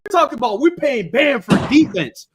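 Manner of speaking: heated yelling at his co-host. Real, not acted. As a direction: raise your voice, furious. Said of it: "why are you yelling?".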